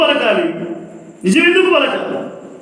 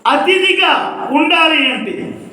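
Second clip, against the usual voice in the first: raised, higher voice, pressing the promise on the congregation; fervent preaching; 5 semitones higher than usual. Acted. Preaching at full force.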